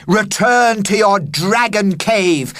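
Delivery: loud high commanding cry; heroic cry. Acted. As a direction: declaim it like a knight. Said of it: "I cried".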